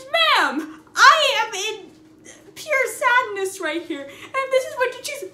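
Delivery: loud, high, exasperated plea to a stranger; exasperated scolding. Retold or imitated. Scolding the woman beside him, loud and high. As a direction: raise your voice at her in disbelief.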